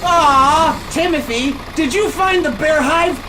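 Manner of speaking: high and loud; excited greeting. Real, not acted. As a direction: greet him with eager surprise. Excited greeting someone arriving.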